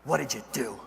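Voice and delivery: low, quiet stunned crew chief; a lightly voiced worried crew chief. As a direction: hush it out in disbelief. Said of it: "and he goes".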